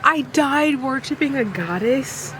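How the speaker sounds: giddy fangirl repeat. Real, not acted. Reader repeats the romance quote to viewers, gushing.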